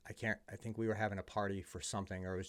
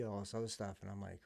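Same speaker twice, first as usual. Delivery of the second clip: normal talking voice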